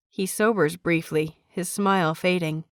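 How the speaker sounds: plain reading voice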